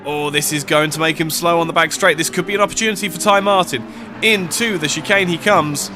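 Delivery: fast, lively play-by-play call; live race commentary. Acted. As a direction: call the final laps like live sport.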